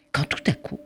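breathy hush before the twist; suspense whisper. A narrator's reading. Narrator hushing before the doorbell rings, whispered.

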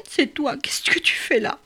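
hushed secret whisper; breathless whispered question. Acted. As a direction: whisper so nobody hears. Secret meeting, hidden bird.

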